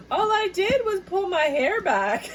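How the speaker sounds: incredulous giggling quote; laughing protest. Pretend. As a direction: squeak it through laughter.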